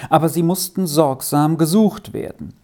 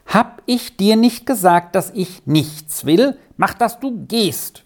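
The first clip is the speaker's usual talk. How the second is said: irritated farmwife; 7 semitones higher than usual. A narrator's reading. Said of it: "wiederholte die Frau".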